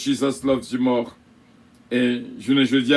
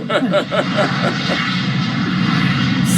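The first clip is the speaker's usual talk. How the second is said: breathy burst of laughter; hearty laugh; 4 semitones higher than usual. Real, not acted. Laughs after the announcement.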